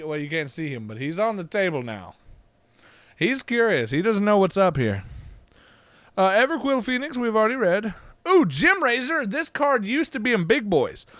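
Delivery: normal talking voice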